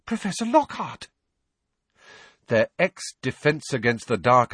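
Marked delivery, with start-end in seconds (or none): 0.1-1.1 high, astonished breathless cry; name him in amazed recognition. A narrator's reading. Girl recognising her former teacher, breathless and high. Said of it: "sounding breathless".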